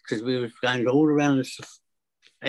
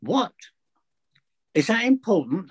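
incredulous teacher; 6 semitones higher than usual. Retold or imitated.

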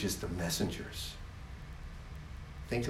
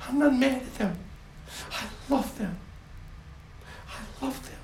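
tender love. Acted. God tender toward people.

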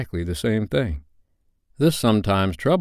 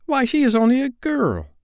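very high indignant disbelief; scandalized boy's high voice; aghast. A narrator's reading. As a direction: protest as if it's absurd. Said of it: "John said aghast".

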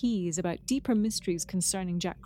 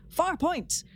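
imitating Picard; 9 semitones higher than usual. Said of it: "and Picard says".

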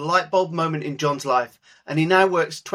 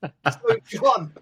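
mock West Country accent; 6 semitones higher, slower than usual. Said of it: "in your finest West Country accent".

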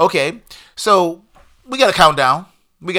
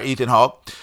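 usual speaking voice

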